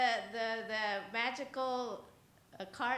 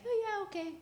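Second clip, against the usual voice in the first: put-on young kids' voice; 13 semitones higher, 4 dB louder, faster than usual. Kids agreeing to wait.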